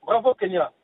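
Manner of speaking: usual speaking voice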